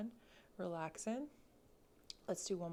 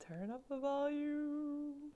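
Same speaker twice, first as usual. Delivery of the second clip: louder, higher cue; 9 semitones higher, 4 dB louder, slower than usual. Shown as a demonstration. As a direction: turn up the volume, raised high and slow.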